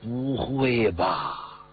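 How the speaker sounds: very slow, drawn-out disbelief; dubious, dragging reply; doubtful denial. A narrator's reading. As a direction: stretch it out as you shake off the suggestion. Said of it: "用怀疑的口气在问他， 所以他把脑袋一拨楞".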